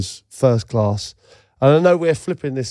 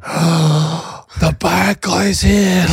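put-on slow, higher, hushed-awe kid voice; a small son stunned as the villain arrives, in slow higher awe; awed child; 6 semitones higher, much slower than usual. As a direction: drag it out like a wide-eyed little boy. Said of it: "Jack just went".

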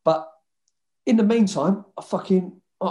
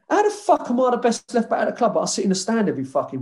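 high-pitched, incredulous retort; puzzled disbelief; 7 semitones higher than usual. Retold or imitated. Benched player recalling the manager's praise, baffled. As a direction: question it as if it makes no sense. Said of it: "it puzzled me even now".

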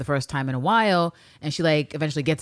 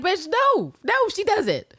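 squeaky, high sassy retort; sassy high mock voice; slower than usual. Voicing Eve mockingly high.

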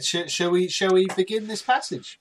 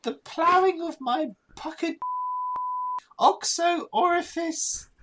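mock German accent. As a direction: drag out the words in a silly German accent. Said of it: "Do it in a German voice".

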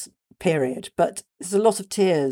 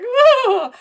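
a big startled whoa, much higher and louder; imitated whoa; 11 dB louder than usual. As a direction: mimic a politician caught off guard, yelping whoa. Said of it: "you can feel him going".

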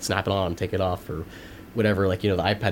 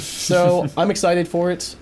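bright, louder and higher; excited; 9 semitones higher, 5 dB louder, slower than usual. Real, not acted. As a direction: lift with excitement. Excited about new tablets.

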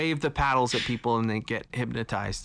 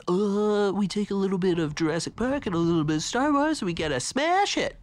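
higher, quick, playful voice; goofy author voice; 7 semitones higher than usual. Retold or imitated. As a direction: pitch it like a scheming writer. Voicing the author's pitch.

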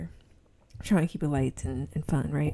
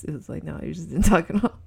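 ordinary delivery

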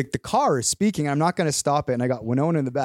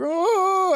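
long, high mock cry; imitated child's wail. Dad imitating his daughter hugging the bear, high mock wail. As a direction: wail like a kid clinging to a toy.

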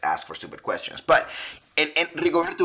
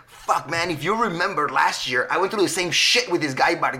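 imitating Rigo; 7 semitones higher, 6 dB louder than usual. Mimicking Rigo's griping.

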